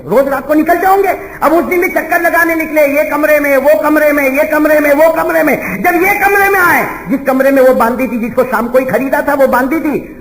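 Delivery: loud, high preaching build; rising sermon narration. Acted. Preacher telling his congregation a night story, rising loud. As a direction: drive the story up in full preacher voice.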